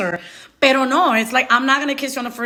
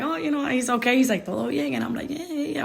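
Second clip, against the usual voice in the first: much softer, offhand murmur; retold hushed exchange; 7 dB quieter than usual. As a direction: keep it quiet and noncommittal. Low-key exchange with brother retold.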